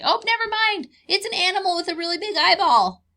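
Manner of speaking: a bird backing off in alarm; startled bird. Pretend. Explaining camouflage to children, as a spooked bird in a high voice. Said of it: "they might look over and say".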